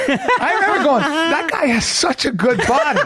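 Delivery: high, laughing through the words. Real, not acted. Remembering admiring a fighter's body, laughing. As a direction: giggle while admiring the guy.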